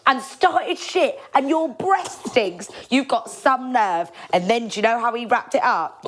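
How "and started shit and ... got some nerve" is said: high, drawn-out mocking jeer; mock insult. Jack taunting the woman, slow, high mocking jeer. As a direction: stretch the insult out slowly and high. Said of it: "He goes".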